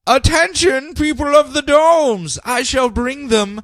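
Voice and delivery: high, slow, grand villain proclamation; mock villain addressing the domes; booming villain announcement. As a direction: proclaim to the people like a cartoon tyrant.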